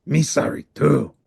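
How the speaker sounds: a touch of gruff monster voice; lightly voiced hungry monster; Cookie Monster. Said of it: "said Cookie Monster".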